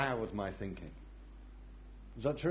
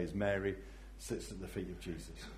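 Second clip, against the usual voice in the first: normal talking voice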